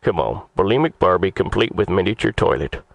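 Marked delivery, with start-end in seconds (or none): none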